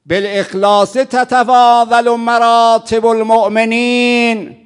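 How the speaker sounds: very loud, high, slow chanted sermon; impassioned preaching. Acted. Preacher proclaiming the Imam's saying, loud high chant. Quoting Imam in peak sermon.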